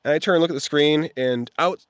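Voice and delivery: ordinary talk, nothing marked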